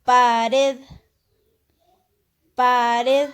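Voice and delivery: loud pared, pa-RED; teacher sounds out pared, weight on red; stress on last syllable. Shown as a demonstration. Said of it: "es una palabra aguda". (pared fuerte, acento en la última sílaba)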